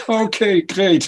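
a real laugh running into okay; chuckle, then say okay. Real, not acted. Laughs at compliment on accent.

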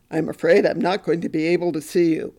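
lightly voiced psychiatrist turning her away; curt psychiatrist. Psychiatrist dismisses the unhappy young housewife, lightly voiced and curt. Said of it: "and he said".

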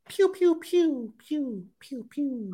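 high, slow pew pew; playful blaster noises; finger gun sounds. Pretend. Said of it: "Those are finger guns".

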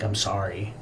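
soft, low mumble; imitated muttering. Burning wife to her husband, quietly muttered apology. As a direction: mutter it faintly, trailing down. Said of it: "muttering to him".

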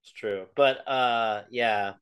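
ordinary delivery

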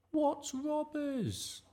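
very high, drawn-out baffled question; baffled mum. A narrator's reading. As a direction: ask it in high-pitched disbelief, slowly. Baffled Mum questions her son.

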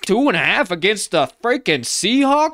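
put-on high, loud, indignant Yinzer accent. Steelers fan scoffing at West Coast teams, loud Yinzer accent. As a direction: shout it like a fired-up Pittsburgh fan, Yinzer accent. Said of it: "That's my best Yinzer accent".

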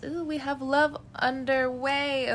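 high, slow, delighted ooh; thrilled at the card; excited. Real, not acted.

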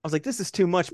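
high, incredulous exclaim; overwhelmed disbelief; stunned. Retold or imitated. Retold own reaction.

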